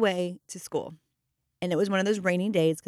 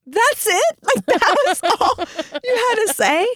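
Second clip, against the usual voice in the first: loud, very high disbelief; hammed-up parent floored by the anticlimax; retold incredulous outburst; 14 semitones higher, 5 dB louder, slower than usual. Mother hears the trivial sentence.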